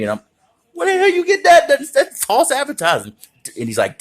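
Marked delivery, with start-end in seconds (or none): 0.7-3.1 very high, loud indignant squawk; outraged kid. Retold or imitated. Kid outraged at cashier over price, high loud squawk. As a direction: shriek at the unfair price.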